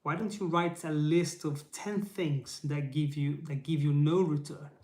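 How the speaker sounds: normal talking voice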